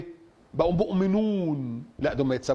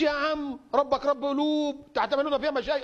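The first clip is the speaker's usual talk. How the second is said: high-pitched mock objector; higher, protesting imitation of a layman; dismissive objector; 7 semitones higher than usual. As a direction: put on a higher voice for someone brushing off the preacher. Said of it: "ما تقوليش".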